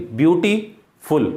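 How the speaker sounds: Beautiful slowly broken, a gap before फुल; split into syllables. Shown as a demonstration. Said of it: "यहाँ syllable एक से ज़्यादा है".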